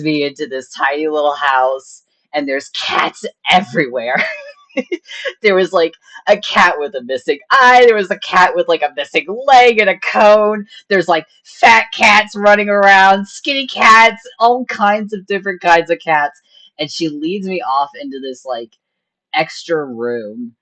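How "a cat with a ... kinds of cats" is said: excited describing. Real, not acted. Describing cats everywhere.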